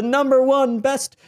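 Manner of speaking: a high, loud squeal; excited shout. Real, not acted. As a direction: belt it out like a winner's announcement. Announcing the top pick.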